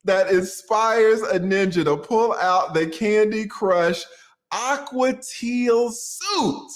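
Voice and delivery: slow dramatic reading. Pretend.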